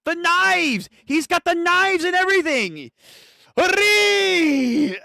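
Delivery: high loud hyped yelling; excited yell. Real, not acted. Fan yelling to stream as the villain powers up. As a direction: shout it like you can't contain it. Excited reaction, cry shout.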